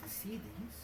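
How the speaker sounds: soft storytelling voice. Acted. GM narrates in character.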